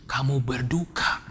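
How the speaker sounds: low, soft, measured counsellor voice; counselling lecturer. Retold or imitated. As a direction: gently explain to a grieving student. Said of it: "Terus dosen saya bilang".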